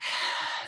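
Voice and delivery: soft acted sigh. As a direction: let out an audible breath as the dwarf. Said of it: "the dwarf sighs".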